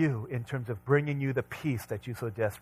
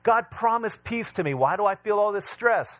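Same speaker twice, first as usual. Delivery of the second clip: higher, louder aggrieved believer; mock complaint to God; 8 semitones higher, 6 dB louder than usual. Believer grumbling that God broke his promises, higher and louder. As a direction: complain to God, building each line. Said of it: "we think to ourselves".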